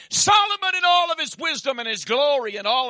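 loud, high preaching cry; preaching shout. Acted. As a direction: lift the voice to the rafters. Preaching burst.

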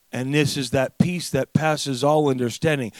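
usual speaking voice

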